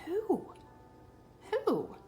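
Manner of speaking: plain reading voice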